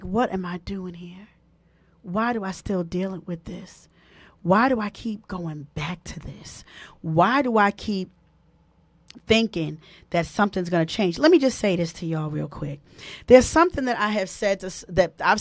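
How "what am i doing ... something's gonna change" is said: inner voice. Retold or imitated. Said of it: "there's a part of you that is like".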